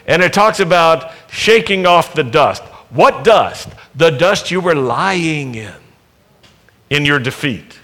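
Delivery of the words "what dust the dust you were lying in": ordinary talk, nothing marked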